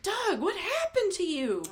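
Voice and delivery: high, drawn-out mock dismay; slow, high-pitched retold disbelief; dismayed reaction. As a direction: exclaim in shock at how someone has changed. Acts out dismayed first reaction.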